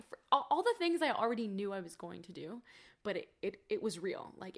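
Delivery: ordinary talk, nothing marked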